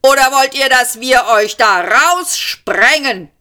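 high shouted threat, slowing at the end; shouting policeman. A narrator's reading. Policeman threatening to blast them out, shouting. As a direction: bellow the threat, drawing it out. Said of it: "schrie wieder einer".

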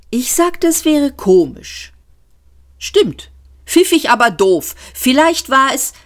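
high piping squabble; high character voice. Acted. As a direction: argue in a piping voice.

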